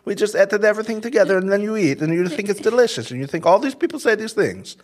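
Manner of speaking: high, fast hammed-up Italian chef accent; Italian impression. Producers as a Michelin-star chef, hammed-up Italian accent. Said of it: "that person was Italian that I just did".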